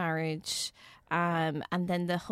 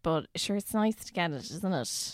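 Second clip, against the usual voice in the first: usual speaking voice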